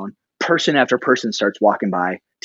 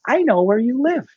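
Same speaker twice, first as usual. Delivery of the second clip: put-on high, little-kid voice; first-grade boy; 13 semitones higher than usual. Foster kid to the pastor after service, high child voice. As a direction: pitch it way up like a small child. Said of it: "he says it again".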